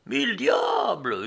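high, drawn-out angry cry; howled curse. A narrator's reading. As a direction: howl the oath in outrage. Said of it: "hurla de nouveau le gros homme".

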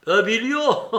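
loud, high cry breaking into a laugh; amused shout tipping into laughter; laughing exclamation. A narrator's reading. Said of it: "s'écria en riant Mitya".

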